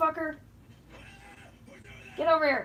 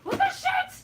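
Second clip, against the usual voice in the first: much higher shocked yelp; shocked squeak; 3 dB louder than usual. Real, not acted. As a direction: squeak it out in disbelief. Startled by the bug.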